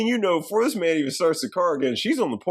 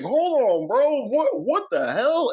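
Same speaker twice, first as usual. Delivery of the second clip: much higher-pitched alarmed protest; mock freak-out; 10 semitones higher, slower than usual. Real Nikki fighting the wish, high imitated freak-out. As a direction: act out freaking out, voice jumping up. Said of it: "she freaks the fuck out".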